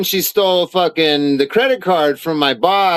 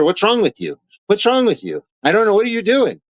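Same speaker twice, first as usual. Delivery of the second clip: exasperated asking; 6 semitones higher than usual. Retold or imitated. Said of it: "And I asked her".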